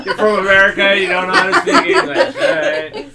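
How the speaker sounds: giggling mock scolding; laughing teasing. Real, not acted. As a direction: tease between laughs. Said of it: "excuse me".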